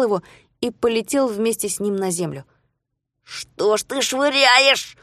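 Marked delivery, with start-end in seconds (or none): 3.3-5.0 slow, high angry hiss. Acted. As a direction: hiss the words in anger. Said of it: "зашипел на него Жулио".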